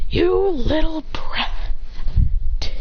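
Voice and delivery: hammed-up indignant villain; mock outrage. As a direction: drag out the insult in character. Raikage insults the young fighter.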